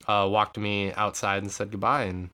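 usual speaking voice

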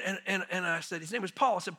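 ordinary talk, nothing marked

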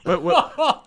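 high squeaky laugh through words. Real, not acted. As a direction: burst out laughing mid-question. Reacting to the joke, laughing.

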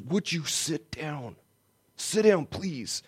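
slow, soft, strained plea to sit; tense stranger's request. Retold or imitated. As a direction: hold back anger, say it slowly. Atheist quoted, tense.

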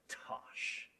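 soft, slow, low dismissive hiss of a serpent; lightly voiced sly serpent, hushed and slow; sneering snake leaning in. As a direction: lean in and scoff like a tempting snake. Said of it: "the snake leans in".

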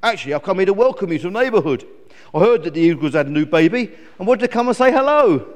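brisk, loud raven character; lightly voiced sociable raven; friendly raven. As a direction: welcome the neighbour as a chatty bird.

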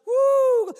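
very high, loud whoop; joyful woo. Retold or imitated. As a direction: let the praise burst out. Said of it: "she would walk around and just".

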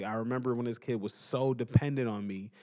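ordinary talk

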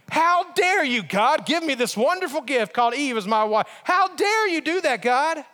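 high-pitched, hammed-up outrage; indignant blame. Adam blaming God for giving him Eve, mock-indignant. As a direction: act affronted and throw the blame back. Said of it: "he blamed God himself".

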